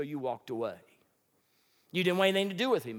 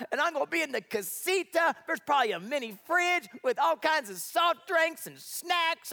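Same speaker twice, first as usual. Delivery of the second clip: higher, eager voice of Elijah daydreaming; excited Elijah; 6 semitones higher, 3 dB louder than usual. Retold or imitated. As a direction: play Elijah giddy about comfort. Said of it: "Elijah's gotta be thinking".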